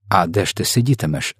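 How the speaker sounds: plain reading voice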